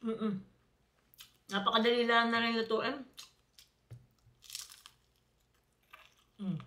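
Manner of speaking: talking while chewing; words muffled by food; mouth full of chicken. Real, not acted. Seen 0: Cheeks bulge, lips working at start; holds chicken, keeps chewing while talking.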